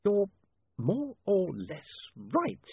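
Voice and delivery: slow, slightly hoarse admission; a hoarse, reluctant concession; a little hoarse. A narrator's reading. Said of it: "a little hoarsely".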